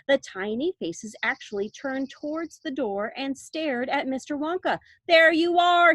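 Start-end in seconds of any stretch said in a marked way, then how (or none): 5.1-5.9 loud triumphant cry. A narrator's reading. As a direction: crow it out in triumph. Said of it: "he cried triumphantly".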